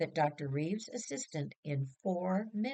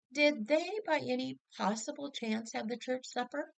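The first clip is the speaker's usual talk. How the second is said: amused question; 9 semitones higher than usual. Acted. Mother amused, chuckles forming.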